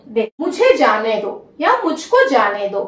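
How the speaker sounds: normal talking voice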